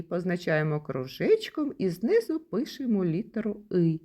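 ordinary delivery

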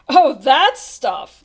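slow, loud, amused drawl; laughing dismissal. A narrator's reading. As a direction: brush it off with amusement. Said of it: "laughed the captain".

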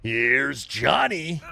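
slow drawn-out menacing shout; creepy movie quote. Acted. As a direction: stretch it out like a horror villain. Horror movie quote while hunting.

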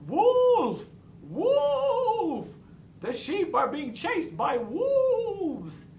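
high, drawn-out shout of alarm; shouted false alarm. A narrator's reading. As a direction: cry wolf loudly, stretching out the words. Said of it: "he shouted".

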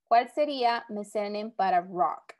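ordinary delivery

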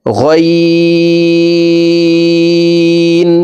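"গইন" said full and thick, held very long on a high level tone; heavy, stretched ghain. Shown as a demonstration. Teacher demonstrating a heavy letter, long chanted hold. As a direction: draw out a heavy "গইন" as one steady note. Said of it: "গইন মোটা করে পড়তে হবে".

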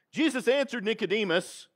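ordinary talk

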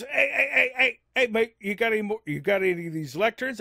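hammed-up eager Aussie mate; mock eager. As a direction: badger him, hey hey hey. Friend's voice in a retold chat.

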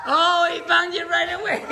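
high delighted laugh through the words; giggling with delight. Real, not acted. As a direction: burst out laughing at the dog. Laughing at the dog.

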